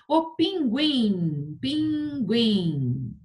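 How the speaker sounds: "pinguim" very slow, each syllable stretched. Shown as a demonstration. Teacher testing pupils on pinguim, sounded out by syllable. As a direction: sound out "pinguim" as long PIN, long GUIM.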